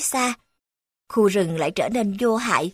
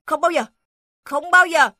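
higher, louder defiant refusal; defiant shout; 6 semitones higher, 5 dB louder than usual. Acted. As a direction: refuse loyally, louder each time. Dog defies all the beasts. (tiếng chó hét lên kiên quyết)

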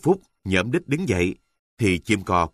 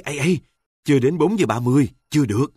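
urgent refusal; 6 semitones higher, 3 dB louder, much faster than usual. Acted. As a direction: push him off with a raised, higher voice. Wife pushing husband off, refusing.

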